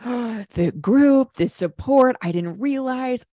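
gasp, then high, drawn-out amazement; acted grateful realization. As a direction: imitate an awed, relieved revelation. Acting out women's grateful realization.

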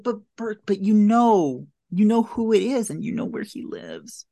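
exasperated plea. Retold or imitated. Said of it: "And it's like".

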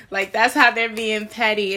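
a loud laugh running into words. Real, not acted. Reader to viewers about the petty partner, loud amused laugh. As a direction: chuckle it out, amused.

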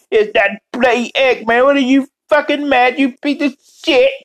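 high, put-on cartoon scolding; hammed-up high-pitched impression, mock indignant; Robotnik Cat sixty-nine. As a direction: berate them in a high character voice. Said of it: "Robotnik Cat sixty-nine".

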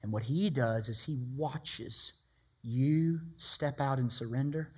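usual speaking voice

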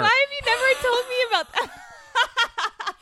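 high, loud, drawn-out wail; mock wail. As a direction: wail through laughter in mock dismay. Mock dismay at being imitated.

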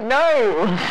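high, loud comic protest; alarmed refusal. Retold or imitated. Customer refusing the masseuse's question, high loud yelp. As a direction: cry it out, scandalised and high.

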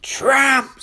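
loud, high screamed insult; angry scream. Acted. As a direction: scream it at a rival. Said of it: "screams Mira".

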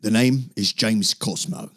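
a hint of a Scottish accent on the name; lightly put-on Scottish announcer voice. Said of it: "I don't know why I just did it".

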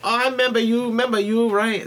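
high, loud mock shop-lady voice; Chinese counter lady. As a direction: imitate her loud, high-pitched recognition. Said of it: "And she's like".

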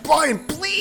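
high pleading whine. Retold or imitated. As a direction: beg him to stop. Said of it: "And I was like".

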